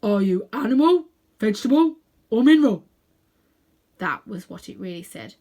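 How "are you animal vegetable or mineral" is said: brisk, louder bulldog voice; businesslike bulldog. A narrator's reading. The bulldog questions Uncle Andrew in a brisk, businesslike way. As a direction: address him like an officious bulldog. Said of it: "in his businesslike way".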